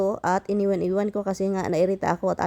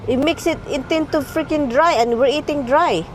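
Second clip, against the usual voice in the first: heated complaint; 6 semitones higher than usual. Real, not acted. Wife scolding husband over dry pork, heated. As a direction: push back hard about the pork.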